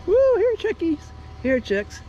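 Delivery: high sing-song chicken call. Real, not acted. Calling chickens to feed.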